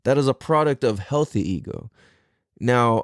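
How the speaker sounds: ordinary talk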